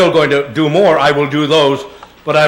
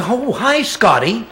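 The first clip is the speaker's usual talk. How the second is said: higher-pitched, playful secretary greeting; imitated secretary; 7 semitones higher, 3 dB quieter, slower than usual. Secretary greets the typecast actor on sight, imitated high greeting. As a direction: greet him in a put-on higher voice. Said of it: "the secretary would say".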